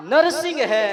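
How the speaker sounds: नरसिंह है called out loud and high; proclaimed name. Acted. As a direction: thunder out Narasimha है. God proclaims his name.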